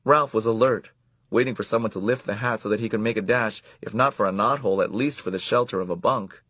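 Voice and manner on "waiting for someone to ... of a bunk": ordinary delivery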